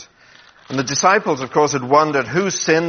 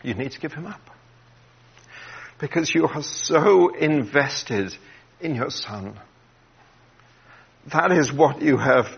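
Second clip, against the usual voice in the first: emotional; 3 dB quieter than usual. Real, not acted.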